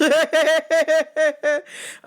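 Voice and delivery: high, loud burst of laughter. Real, not acted. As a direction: laugh hard at your own line. Laughing at own joke.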